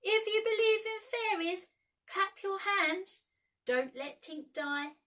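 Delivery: high, loud slow plea; calling out. A narrator's reading. Peter calling to all children, high, loud and slow. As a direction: call to every dreaming child. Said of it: "Peter called to every child".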